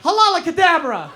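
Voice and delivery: high, loud incantation, building; casting spells. Acted. Comic reciting fake spells, rising loud chant. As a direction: shout out spells like a wizard.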